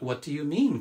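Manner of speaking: ordinary talk, nothing marked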